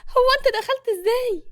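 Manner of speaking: high, loud alarmed question; startled woman, voice shooting up; shocked and terrified. A narrator's reading. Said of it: "قالت بصدمة وبرعب". (بصدمة ورعب)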